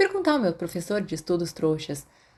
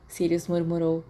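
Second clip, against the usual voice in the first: ordinary delivery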